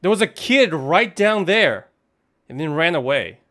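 blurting out what you just saw; startled excitement. Real, not acted. Streamer startled after seeing a ghost kid, loud and high.

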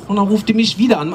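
ordinary talk

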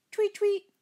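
very high, birdlike tweeting; squeaky high teasing chirp. Acted. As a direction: tweet mockingly at your sister. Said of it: "he teased".

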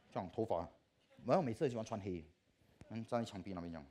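ordinary talk

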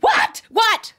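high, urgent double what; mock-breathless high questioning; imitated alarm. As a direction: burst in demanding the news. Sibling runs in asking what.

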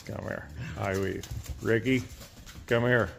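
usual speaking voice

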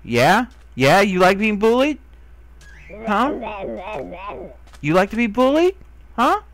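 loud, high, mocking jeer; angry taunt. Real, not acted. Taunting the creature that stole his mask, loud and jeering.

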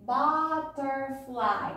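slow butterfly, BA - TER - FLY in three separate beats; syllable by syllable. Shown as a demonstration. Teacher letting children count syllables, butterfly said beat by beat. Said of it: "how many syllables do you hear?".

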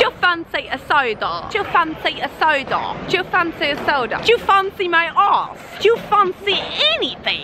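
high, hammed-up British accent; mock British accent. Shown as a demonstration. Showing off a practised British accent, hammed-up and high. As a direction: show off a put-on British accent, pitched high. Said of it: "the perfect sentence to show my British accent".

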